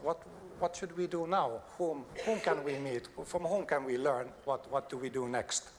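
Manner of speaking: ordinary talk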